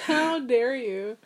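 slow, drawn-out "how dare you"; mock indignation. Imagined retort to her little cousin, slow mock outrage. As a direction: stretch it out in playful outrage.

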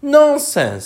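high, forceful cry; loud and decided. A narrator's reading. Alice defies the Queen, very loud and decided. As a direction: cut in loudly and firmly. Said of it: "very loudly and decidedly".

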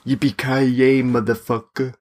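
put-on slow, half-hearted German-accented villain line; Hans Gruber. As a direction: attempt a drawn-out German accent, not quite landing it. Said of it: "a little bit more like Hans Gruber".

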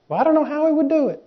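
high, soft mock objection; doubting listener. Preacher mimics a listener unsure how Jesus would do it, mock doubtful. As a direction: pitch it way up and small, mimicking a doubter.

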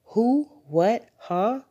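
high-pitched, rising one-word questions; puzzled mother caught off guard; startled questions. A narrator's reading. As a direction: echo the bafflement, each word jumping up. Mother startled by dog idea.

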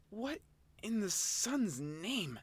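high, slow puzzled creature voice; a dazed little creature asking, drawn out; Oshawott voice. Acted. Dazed Oshawott to itself, high and slow. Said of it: "and said".

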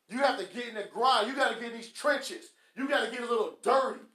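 loud, punched motivational delivery; forceful preaching. Real, not acted. Preacher urging listeners to change their mindset, forceful preaching. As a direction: drive each sentence home loudly.

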